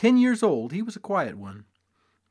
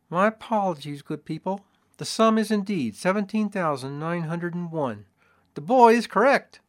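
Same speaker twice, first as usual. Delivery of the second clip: assayer voice; 5 semitones higher, 4 dB louder than usual. A narrator's reading. Assayer apologizes with bow.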